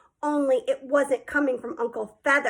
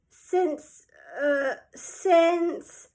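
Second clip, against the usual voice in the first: stalling; 4 semitones higher, much slower than usual. Acted. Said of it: "I could tell he was stalling".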